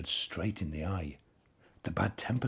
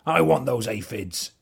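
grumpy; 5 semitones higher, 7 dB louder than usual. A narrator's reading. Bad-tempered ladybird demands.

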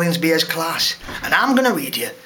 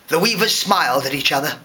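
ordinary delivery

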